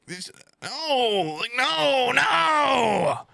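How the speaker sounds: high whine building to a shout; rising high protest, yelling the last no; whiny protest. Retold or imitated. High whine building to a shout, refusing to get up and pee again.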